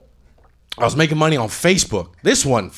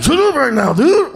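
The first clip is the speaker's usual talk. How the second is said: sudden yell; 13 semitones higher, 5 dB louder than usual. Retold or imitated. Scammer yelling at his barking dog.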